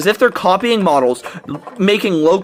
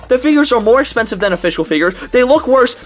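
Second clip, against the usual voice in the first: normal talking voice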